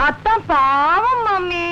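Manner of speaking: slow, drawn-out daughterly plea; long, lilting sympathetic whine; pleading pity. Acted. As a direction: stretch it out, begging mummy to take pity. Daughter pleads with mother for cousin.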